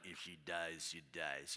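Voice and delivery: low, soft, flat menace of a movie boxer; cold Russian boxer. Retold or imitated. Quoting Rocky's Russian rival while staring down his wife, low and soft. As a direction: drop the voice to a low, soft, unmoved threat. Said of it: "like Rocky did the Russian guy in Rocky four".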